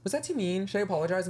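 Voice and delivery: high, meek worried voice; mock timid self. To her team after a bad interaction, meek and worried. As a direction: play it small and unsure. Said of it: "I'll be like".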